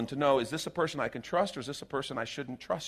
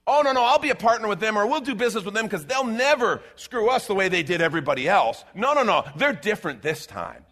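fast, loud, higher mock insistence; overconfident partner; 5 semitones higher, 5 dB louder than usual. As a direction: wave off the doubts breezily. Quoting a foolish business partner.

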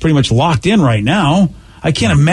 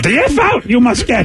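higher Yoda with backwards words; 8 semitones higher than usual. Retold or imitated. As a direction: scold like a tiny Jedi master. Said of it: "You know what Yoda would say to you?".

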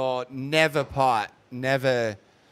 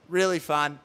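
ordinary delivery

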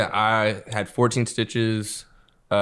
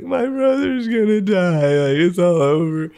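much higher, slower wailing sob, hammed-up; mock sobbing; 8 semitones higher, 6 dB louder, slower than usual. Sister sobbing over her brother's face, hammed-up high wail. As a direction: wail it like the world is ending, imitating. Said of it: "like, sobbing in my room".

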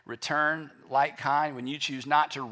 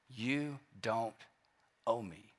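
soft, much quieter, gentle release; tender forgiveness; 4 semitones lower, 9 dB quieter than usual. Shown as a demonstration. Preacher models forgiving an enemy, soft and tender. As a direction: say it like setting someone free.

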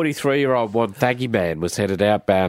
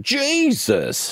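sudden high shocked cry; startled exclamation. Retold or imitated. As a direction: blurt it out in fright. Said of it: "Where you just think".

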